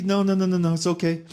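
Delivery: high-pitched, hurried brush-off; mock polite refusal. Customer declining the server's offer, high-pitched mock politeness.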